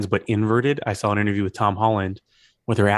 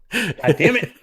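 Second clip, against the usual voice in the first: mock frustration; 11 semitones higher, slower than usual. Mock frustration at lost role.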